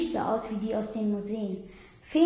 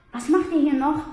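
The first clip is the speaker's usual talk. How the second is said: ängstlich; 5 semitones higher, 7 dB louder than usual. A narrator's reading. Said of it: "fragte er ängstlich".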